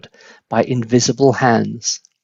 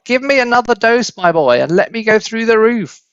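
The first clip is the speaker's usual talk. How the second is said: far higher screeching demand; screechy crone; 9 semitones higher than usual. A narrator's reading. Grandma demanding another dose from the attic, high screech. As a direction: screech for more like a witch. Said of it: "old screechy voice".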